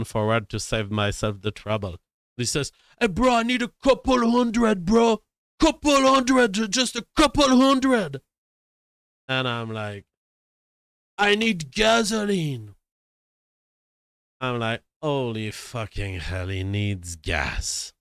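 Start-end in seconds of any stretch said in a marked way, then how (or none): 3.0-8.2 high, loud, urgent begging voice; hammed-up mimicry of a pushy beggar; pleading hustler. Imitates a man begging for money. 11.2-12.7 high, drawn-out mock plea; hammed-up desperate beggar; pleading hustler. Imitates the man begging for gas. 15.0-17.9 low, slow, dismayed aside; acted exasperated inner voice; weary disbelief. His own weary aside in retelling.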